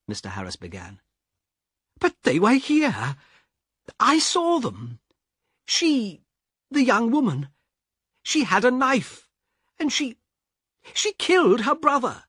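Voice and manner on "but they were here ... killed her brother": high, pleading insistence; a frightened passenger arguing with the guard; insistent shaken man. Acted. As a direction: convince him you saw it.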